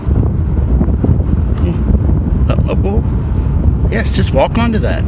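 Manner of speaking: high coaxing voice to a crab. Real, not acted. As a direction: sweet-talk a little critter.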